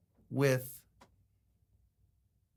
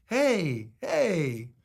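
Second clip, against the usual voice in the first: friendly hey hey. Retold or imitated. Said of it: "A lot of people say".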